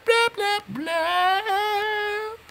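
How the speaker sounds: high squeaky drawn-out blahs; mocking blah-blah. Mimicking people who can talk however they like, mocking blah-blah. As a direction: squeal out meaningless chatter in a silly high voice. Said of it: "You can be all like".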